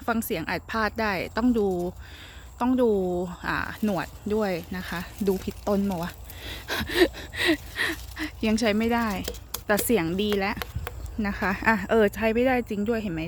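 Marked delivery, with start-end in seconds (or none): 6.1-8.4 breathless laugh. Real, not acted. Laughing at picking wrong vine.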